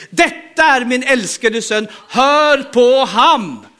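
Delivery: slow, loud heavenly voice proclaiming "Detta är min älskade son". Acted. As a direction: proclaim it slowly like God speaking from the clouds. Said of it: "en röst från himlen säger".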